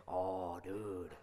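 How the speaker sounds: low, let-down reply; mock dismay. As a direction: drop it low, gently disappointed. Retold dad's let-down reply to son.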